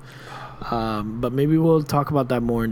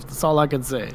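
normal talking voice

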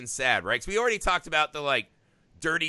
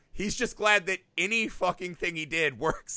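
usual speaking voice

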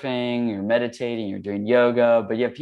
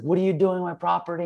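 higher, confronting a prowler; angry; 8 semitones higher, faster than usual. Retold or imitated. Homeowner confronting a prowler beside his house, higher, retold anger. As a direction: demand it of the intruder, mock angry. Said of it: "And I was really angry".

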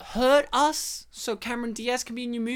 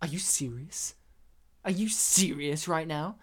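low, quiet affront; retold icy challenge, kept soft; offended disbelief; 5 semitones lower, 6 dB quieter than usual. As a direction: say it low, as if insulted. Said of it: "she just turned around and went".

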